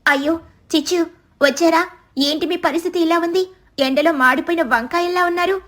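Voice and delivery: a touch of teasing astonishment; surprised bird friend. Acted. Friend greets worn-out crows.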